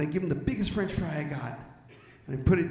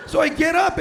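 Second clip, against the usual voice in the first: excited shout; 11 semitones higher, 11 dB louder than usual. Real, not acted. Still ranting about bird.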